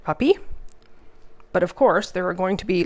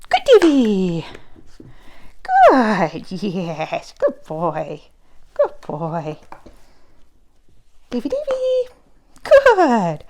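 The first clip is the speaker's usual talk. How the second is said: praising the puppy; much slower than usual. Real, not acted. Praising puppy during training.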